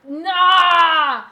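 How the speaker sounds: long, high, loud "nah"; high blurted shout; loud cut-off cry. Real, not acted. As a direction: drown out the word with a sudden yell. Cuts off word dog knows.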